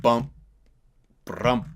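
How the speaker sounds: loud mouthed drum bumps; beatbox the tom fill with your voice; imitated tom hits. Shown as a demonstration. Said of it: "bump like that".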